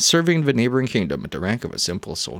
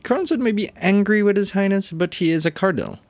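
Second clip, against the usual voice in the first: higher-pitched game character, mildly protesting; lightly voiced indignant defender; 12 semitones higher than usual. Warrior defends the cardinal to a questioner, mildly indignant.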